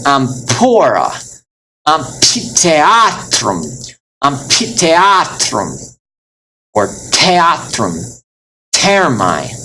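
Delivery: slow amphora, amphitheatrum, theatrum, thermae with puffed p and t; overdone aspiration. Shown as a demonstration. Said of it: "overdoing it".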